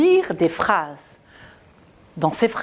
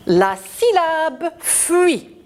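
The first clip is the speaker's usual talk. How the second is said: slow, high, syllable by syllable; slow model reading; 7 semitones higher, slower than usual. Shown as a demonstration. Teacher modelling a syllable for children. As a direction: read it slowly for the class to repeat. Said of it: "On y va, mes petits".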